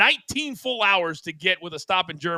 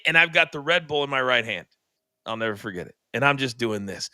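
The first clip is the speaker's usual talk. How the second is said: normal talking voice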